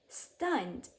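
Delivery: plain reading voice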